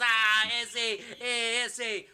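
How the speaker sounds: loud, high put-on Spanish jeering; hammed-up "ese" cries; laughing mock wrestler taunt; Conan. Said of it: "And he goes".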